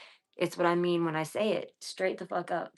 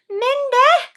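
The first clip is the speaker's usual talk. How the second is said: much higher, much louder shout of the name; excited shout; 13 dB louder than usual. Real, not acted. Streamer shouts a fond greeting to viewer Minde, excited shout. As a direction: yell her name with delight.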